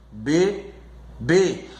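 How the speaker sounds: "bebê" split by a pause, be... bê; slowly divide "bebê" in two; syllable by syllable. Shown as a demonstration. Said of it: "a última sílaba é a mais forte".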